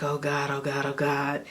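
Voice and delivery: soft, low, nervous prayer under her breath; quietly panicking to herself; nervous muttering. Retold or imitated. Nervous before walking down aisle.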